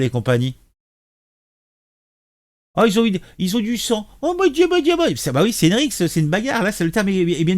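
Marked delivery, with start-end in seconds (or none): 2.8-5.2 much higher, hammed-up gasp of shock; mock horror. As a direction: fake being scandalised. (horreur feinte, voix très haute et théâtrale)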